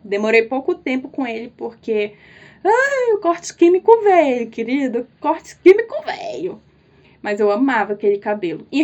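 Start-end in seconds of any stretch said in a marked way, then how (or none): 2.6-6.6 much higher, hammed-up lament; dramatic wail. Vlogger mourning her bleached hair, hammed-up high lament. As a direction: ham up the tragedy, high and theatrical.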